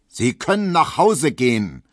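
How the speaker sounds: loud roar to wake a sleepwalker; bellowing judge. A narrator's reading. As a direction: bellow him awake. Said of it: "brüllte der Richter".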